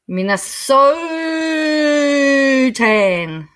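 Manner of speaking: Minnesotan with a hugely stretched, high "SOOO"; min-uh-SOOOO-tan, drawn out and swooping down; exaggerated pronunciation. Shown as a demonstration. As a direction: hold the "so" in Minnesotan absurdly long. Playing with the pronunciation.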